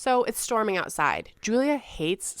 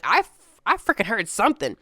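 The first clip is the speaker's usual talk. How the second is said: insistent outburst; 7 dB louder than usual. Pretend. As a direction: blurt it out, sure of what you heard. Rory insists he heard noise.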